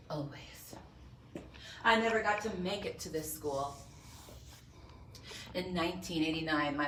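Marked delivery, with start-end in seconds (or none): 0.1-0.7 breathy hushed promise; whisper. Acted. The avenger whispers that he always says yes. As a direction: breathe it out softly.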